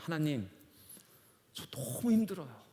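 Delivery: mild, retold weary sigh of words; weary outburst to God. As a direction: let it drop out, worn down. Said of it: "이렇게 툭".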